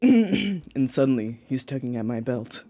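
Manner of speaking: low, soft reading voice; story narration. Acted. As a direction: read it out low and steady.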